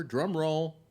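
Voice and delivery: big showman call for a drum roll; loud announcer. Pretend. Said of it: "ready for the big number?".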